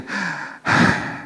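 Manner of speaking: soft tired sigh of a grumpy driver; driver's weary sigh. Shown as a demonstration. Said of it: "nadanya gini".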